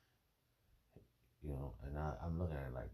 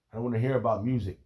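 retold rant; 4 semitones higher, 8 dB louder, faster than usual. Retelling telling off friend.

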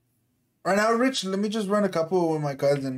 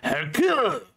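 imitating an anime character name call; mock anime dub, drawn out; overacted anime dub; 6 semitones higher than usual. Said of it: "You sound like an anime character".

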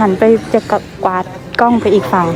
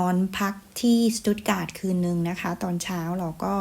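soft, measured travel narration; vlog voice-over; 10 dB quieter than usual. Real, not acted. Summing up the museum visit for viewers, soft voice-over narration. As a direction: narrate the trip evenly and softly, in polite phrases. Recorded voice-over trip summary.

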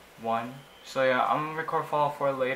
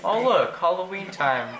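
higher and louder, suddenly excited; real sudden excitement, higher and louder; 4 semitones higher, 7 dB louder than usual. Real, not acted. Spotting something in game.

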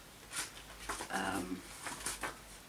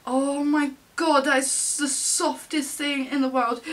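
gushing adoration; 5 semitones higher, 3 dB louder than usual. Real, not acted. Gushing over a sweet photo.

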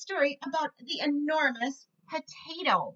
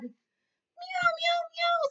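very high mewing cat voice; cat meow; much slower than usual. Acted. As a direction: mew like a small cat. Cat answers.

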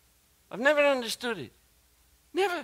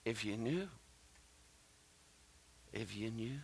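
hushed tender whisper; 9 semitones lower, 12 dB quieter than usual. Retold or imitated. Jesus holding the woman, telling her softly. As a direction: let it fall to a near whisper, full of compassion.

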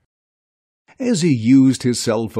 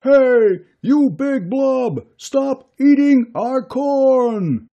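much higher, louder and slow, a farmer's yell across the field; 13 semitones higher, 6 dB louder, slower than usual. Acted. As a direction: shout it out to chase the blob off. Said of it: "yelled Bob".

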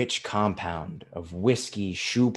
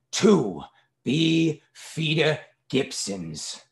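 "Two Beefeater Gibsons" very slow and higher, word by word; slow dictation; 5 semitones higher, much slower than usual. A narrator's reading. Father dictates order to waiter.

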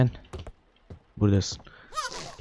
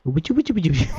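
put-on baby talk; 6 semitones higher than usual. Cooing at the baby.

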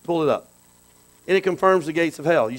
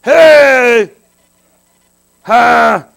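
loud, high bursts of shouting; shaking yourself awake; 4 semitones higher, 12 dB louder than usual. Shown as a demonstration. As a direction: force out big pep-up shouts. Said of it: "had to shake yourself up".

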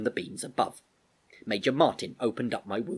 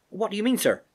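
put-on high, anxious young soldier; much higher and louder, voice trembling with worry; tremor in the voice; 12 semitones higher, 5 dB louder than usual. Albert questioning the Major about his sick horse, anxious tremor. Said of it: "a tremor in his voice".

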